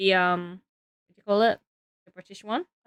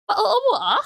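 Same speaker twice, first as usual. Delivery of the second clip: high, playful mock French au revoir; a light jokey French goodbye; 11 semitones higher, 3 dB louder than usual. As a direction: toss off au revoir in a put-on French accent. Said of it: "that's the only word I know".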